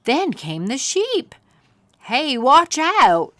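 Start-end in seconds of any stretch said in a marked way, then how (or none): 2.1-3.3 loud, slow call of warning; warning shout. Acted. Cameron warning about the sheep, loud slow shout. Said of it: "said Cameron".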